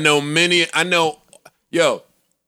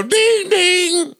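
high sing-song ding of an alarm; imitated alarm chime; 12 semitones higher than usual. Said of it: "the little alarm should go".

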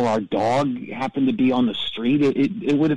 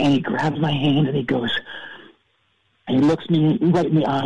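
usual speaking voice

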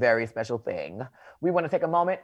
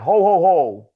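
hearty Santa-style ho-ho-ho, slightly raised; jolly Santa catchphrase; Santa Claus; 3 semitones higher, 6 dB louder than usual. Acted. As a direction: boom it out like Santa. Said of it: "the bear that wears red, and he's like".

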